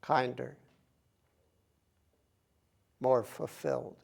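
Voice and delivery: measured phrases with long, spacious pauses; unhurried contemplative recitation, phrase by phrase; guided meditation. Acted. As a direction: guide them gently, leaving silence between phrases. Leads a meditation.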